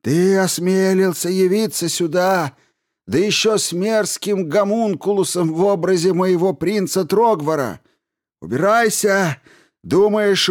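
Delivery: put-on high, loud furious old man; furious shouting. A narrator's reading. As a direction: drive him away with rage. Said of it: "Глаза его гневно сощурились".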